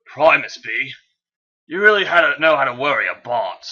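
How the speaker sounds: hammed-up British accent. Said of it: "I am not British".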